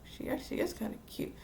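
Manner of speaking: soft, quick near-whisper; private thought. Retold or imitated. Boy secretly admires the girl, private thought. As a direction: slip it out under the breath, imitating a secret thought. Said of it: "he's thinking".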